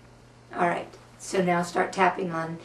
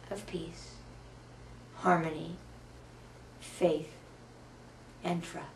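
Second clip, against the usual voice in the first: slow, softer closing affirmations fading away; calm affirmation; 7 dB quieter than usual. Acted. Ending the tapping round for viewers, slow soft gratitude. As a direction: settle into peace and let each thanks fade softer.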